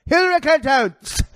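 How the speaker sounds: high, slow effeminate voice with mock Spanish; hammed-up effeminate fake Spanish, high and slow; Tim Kaine impression. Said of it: "my Herman Tim Kaine character".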